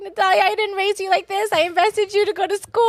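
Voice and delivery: high, hammed-up disappointed mother; disappointed mom. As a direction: play the let-down mother, shocked. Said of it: "She'd be like".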